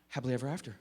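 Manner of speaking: low, hushed storyteller; soft storybook ending. Acted. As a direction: close the bedtime tale softly and low. Savouring a bedtime story ending.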